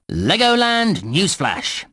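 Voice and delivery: slow, stretched headline announcement; newsflash announcer. Acted. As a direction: announce a breaking bulletin, drawing out the first word. Announces a mock news bulletin.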